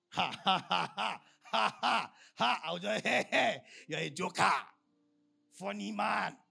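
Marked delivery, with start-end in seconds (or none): 0.1-2.6 a mocking ha ha ha; preacher acting scoffers' mocking ha ha ha; mocking laughter. Mocking reaction to Jesus. 3.1-6.4 a hint of scornful listeners; mocking scoffers. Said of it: "That's what they say".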